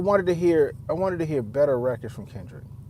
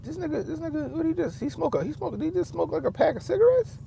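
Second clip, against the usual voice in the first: much higher, softer incredulous quote; mocking disbelief; 12 semitones higher, 4 dB quieter than usual. Mocking [name] sounding out of breath, high incredulous. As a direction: act baffled he's so winded. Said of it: "I was like".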